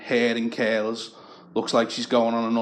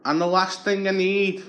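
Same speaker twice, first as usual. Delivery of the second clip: high, chummy wheedling Scouse beggar; scrounger's voice; 7 semitones higher than usual. Retold or imitated. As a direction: sweet-talk the neighbour for a favour. Beggar Tony voiced at door.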